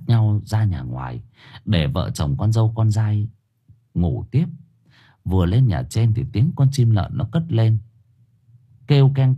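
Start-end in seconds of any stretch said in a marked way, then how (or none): none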